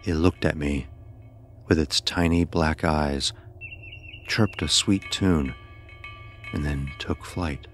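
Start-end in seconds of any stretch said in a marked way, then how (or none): none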